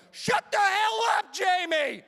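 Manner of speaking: high, drawn-out furious yell; exploding shout. Retold or imitated. Student snapping at nagging classmate in class, exploding shout. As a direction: blow up and scream it, slowly. Finally snaps at classmate mid-lesson.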